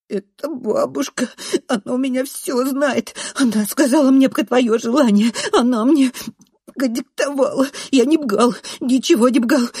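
high, loud, snuffly excuses; whining tearful boy. A narrator's reading. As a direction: gabble excuses tearfully, R mispronounced. Crying boy babbling excuses. (плаксиво оправдывается, картавя)

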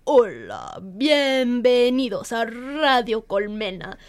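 diep, langzaam Spaans radiostemmetje met gerolde r; Spanish radio presenter. Shown as a demonstration. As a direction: doe een zwoele Spaanse radiopresentator na, veel lager. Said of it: "So a good example would be like".

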